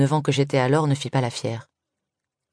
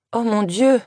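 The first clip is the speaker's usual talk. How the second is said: high, startled cry of surprise; a girl exclaiming, stunned by the crash; dazed exclamation; 9 semitones higher, slower than usual. Acted. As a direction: blurt it out, stunned. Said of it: "s'exclama Parwin tout étourdi".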